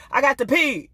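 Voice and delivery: put-on insistent toddler begging to pee. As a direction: whine like a three-year-old who must go. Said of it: "she's like".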